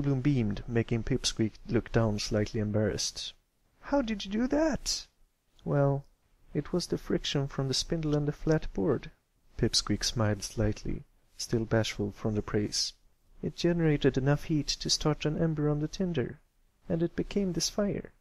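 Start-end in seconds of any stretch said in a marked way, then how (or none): 3.8-5.1 high filly voice, eager; curious young filly. Acted. Filly asking how the fire was started, high and eager. 5.6-18.1 lightly voiced shy colt, a bit higher; bashful colt. Shy colt explaining the fire drill to friends, bashful.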